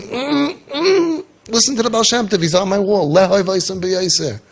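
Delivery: high, laughing mock voice of God; voicing Hashem. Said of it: "And Hashem is saying".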